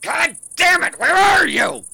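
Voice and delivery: high, very loud outburst; enraged yell at the top of the voice; furious scream. Acted. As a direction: explode and scream at the hidden pests. Explodes at the hidden pests.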